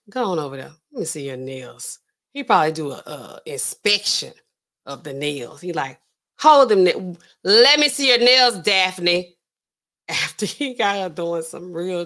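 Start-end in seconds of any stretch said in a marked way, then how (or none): 0.1-2.0 low, softly spoken command; quiet order. Acted. Man ordering Daphne to show her nails, quiet order. 6.4-9.4 louder mock-bossy order; loud demand. Man demanding to inspect Daphne's nails, loud demand.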